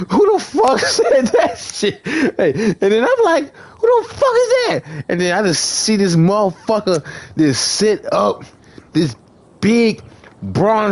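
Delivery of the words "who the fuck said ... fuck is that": high-pitched mock alarm through laughter; shocked outburst. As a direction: act out stunned disbelief, voice leaping up. Retold shock at hidden stranger.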